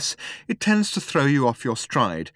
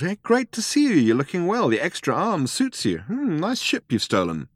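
drawling; 8 semitones higher than usual. A narrator's reading. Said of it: "he drawled".

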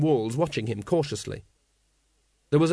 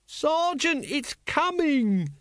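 very high, drawn-out cry of warning; alarmed shout; 14 semitones higher, much slower than usual. A narrator's reading. Carrot warns the dragon is coming, shouting. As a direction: stretch the warning out at top pitch.

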